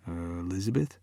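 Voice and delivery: soft, low tentative call; hesitant. A narrator's reading. Boyfriend softly calling to his frozen girlfriend, low and tentative. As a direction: check on her warily.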